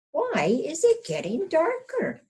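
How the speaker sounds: high, questioning hen; puzzled hen. Acted. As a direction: ask it like a worried little hen.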